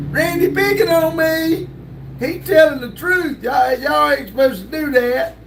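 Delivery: put-on high-pitched grievance; mock complaining. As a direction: protest like a picked-on kid, hammed up. Mock tattling complaint to the chat.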